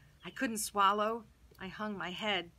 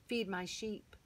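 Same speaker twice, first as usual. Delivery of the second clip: softer tender final charge; gentle; 7 dB quieter, slower than usual. Acted. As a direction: quietly hand over a lifelong job. Jesus gives Peter his life task.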